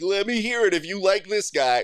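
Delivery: high, loud emcee call; rally the crowd for a vote; dance-off host. Acted. Host at a dance-off asking for cheers, hyped call. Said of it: "dance-offs where you're like".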